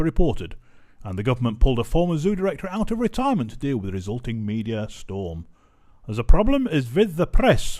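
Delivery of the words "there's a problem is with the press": mock Egyptian accent. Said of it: "Do you like the accent?".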